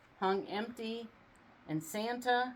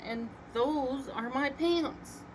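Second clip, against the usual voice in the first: slow, higher, firm; insistent; 6 semitones higher, slower than usual. A narrator's reading. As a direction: lay claim slowly. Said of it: "said Santa".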